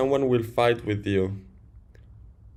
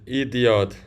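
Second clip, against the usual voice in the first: ordinary delivery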